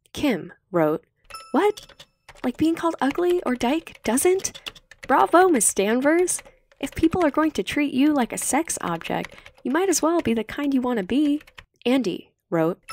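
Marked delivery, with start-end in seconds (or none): 1.5-11.4 lightly voiced teen girl, noticeably higher, indignant; Kim's indignant voice. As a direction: raise the voice a little for the bold, scoffing friend. Kim voiced in chat scene.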